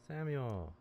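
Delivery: ordinary delivery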